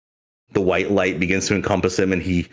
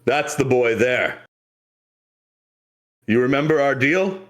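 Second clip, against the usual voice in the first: higher-pitched captain voice, calling in the deal; pirate captain; 5 semitones higher than usual. Acted. Captain reminding Ramses of their deal, role voice. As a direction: voice a captain, pitched up with a rising question at the end. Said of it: "the captain points and goes".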